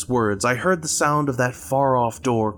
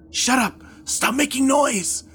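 whispered fury; 14 semitones higher than usual. A narrator's reading. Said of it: "Liam whispered angrily".

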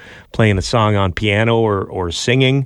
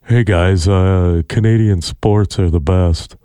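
lightly lowered imitation with a subtle lisp; Devin Peacock impression; 5 semitones lower, slower than usual. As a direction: lower and slower, lisping Canadian sports fan. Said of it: "I'd say it's more like this".

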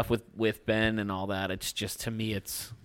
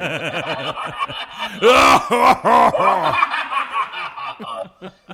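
loud warped laugh through the voice mic; distorted laugh; 11 semitones higher, 9 dB louder than usual. Real, not acted. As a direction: cackle through the pitch effect. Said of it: "It sounds so terrifying".

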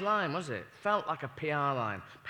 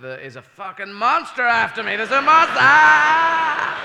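calm turning to a loud high scream; from calm to terrified shout; building to a scream; 7 semitones higher, 11 dB louder, slower than usual. Acted. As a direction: panic rising into a shriek. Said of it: "he could have said".

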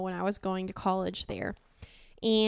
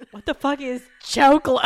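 loud, higher mock bafflement; baffled cooks; 4 semitones higher, 11 dB louder than usual. Line cooks baffled by her word, loud mimicked disbelief. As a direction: exclaim it like a confused cook. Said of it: "And they're like".

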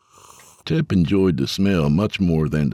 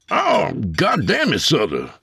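yelp of protest; 11 semitones higher than usual. A narrator's reading. Abel elbowed in the ribs.

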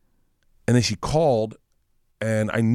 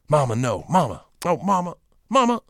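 rising imitation of protesting into a phone; escalating pleading; 4 semitones higher than usual. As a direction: climb in pitch with each plea. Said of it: "all I heard was".

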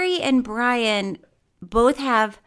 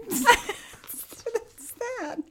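laughing; 14 semitones higher, 5 dB quieter than usual. Real, not acted. Laughing echo.

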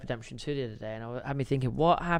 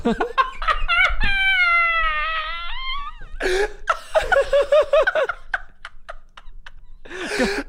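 high, breathless wheezing laugh; squealing laugh; 3 dB louder than usual. Real, not acted. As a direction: crack up until it squeaks. Long laugh after bull story.

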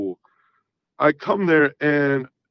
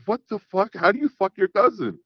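incredulous; 6 semitones higher than usual. Retold or imitated. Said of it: "I was like".

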